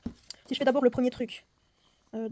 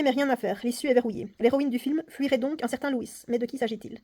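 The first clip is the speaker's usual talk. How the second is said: louder, lively reading of the game text; story reading; 4 dB louder than usual. Acted. Player reading the escape game story aloud, theatrical narration. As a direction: read the booklet like a dramatic tale.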